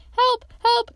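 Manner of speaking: high, loud squeal for help; frightened little creature calling for rescue; panicked cry. A narrator's reading. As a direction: cry out in terror, pitched far up. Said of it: "cried Piglet".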